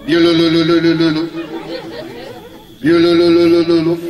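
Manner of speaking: a hammed-up snoring noise; mock snoring. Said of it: "Tu ronfles".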